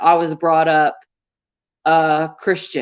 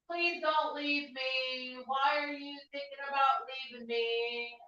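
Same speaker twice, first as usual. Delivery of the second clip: begging an ex back; 5 semitones higher, 17 dB quieter than usual. Pretend. Mock pleading to viewers.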